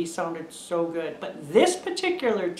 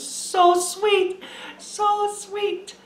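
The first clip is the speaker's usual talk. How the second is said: high, gushing "so sweet"; 13 semitones higher, much slower than usual. Real, not acted. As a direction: melt over the memory. Gushing over granddaughter's hug.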